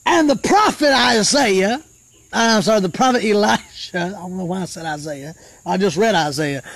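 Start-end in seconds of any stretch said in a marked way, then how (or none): none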